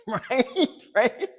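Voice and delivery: high laughing "right? right?". Real, not acted. Coach laughing at his own line to the viewers, high and laughing. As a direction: laugh it out, pleased with himself.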